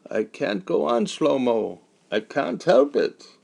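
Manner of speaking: high, strained, broken lament; a strong man breaking down; groaning despair. Acted. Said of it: "Meyer Katz only groaned in response".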